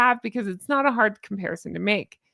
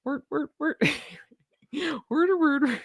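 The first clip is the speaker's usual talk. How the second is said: high mock Swedish gibberish; hammed-up Muppet chef gibberish; Swedish Chef impression; 5 semitones higher than usual. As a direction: babble like a muppet cook. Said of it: "I do a good impression of Swedish Chef".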